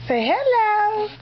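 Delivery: high slow coo of hello. Real, not acted. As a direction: draw out hello sweetly for the little one. Coaxing baby to greet viewers.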